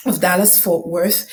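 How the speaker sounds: normal talking voice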